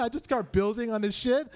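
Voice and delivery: ordinary delivery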